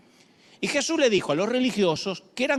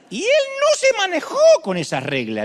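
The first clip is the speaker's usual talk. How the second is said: loud, high burst of conviction; emphatic preaching; 11 semitones higher, 6 dB louder than usual. Real, not acted. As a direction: proclaim it with force. (predicación enfática y fuerte)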